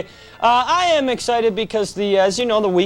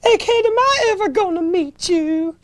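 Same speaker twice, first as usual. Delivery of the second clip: hammed-up Southern Belle falsetto; Southern Belle accent; 8 semitones higher than usual. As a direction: gush like a genteel Southern Belle, pitched up. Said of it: "get a Southern Belle accent".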